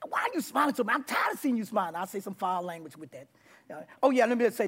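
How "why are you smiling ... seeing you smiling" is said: acting out fed-up harshness; higher-pitched rough complaint; rough and hard. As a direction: confront him like you've had enough of it. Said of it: "I was so rough and hard".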